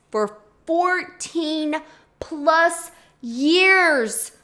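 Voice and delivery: slow, drawn-out stress on "fourteen plus years"; appalled emphasis. Real, not acted. Telling viewers how long she used the dirty brush, appalled emphasis. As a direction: spell out "fourteen plus years" slowly, word by word in dismay.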